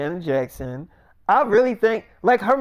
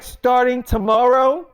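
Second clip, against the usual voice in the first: higher, slow, rising question; incredulous echo; 6 semitones higher, 4 dB louder than usual. Acted. Millie learns Janet joins the cast tomorrow, incredulous. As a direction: repeat it slowly and higher, in disbelief. Millie echoes the wedding news in disbelief.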